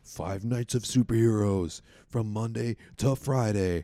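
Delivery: hyped announcer voice, slow and drawn; monster truck ad. Pretend. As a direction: sell it like a TV promo. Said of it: "You sound like the monster truck guys".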